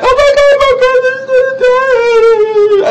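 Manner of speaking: very high, loud squealing falsetto; panicking sister. Retold or imitated. Sister seeing brother choke, high hysterical wail.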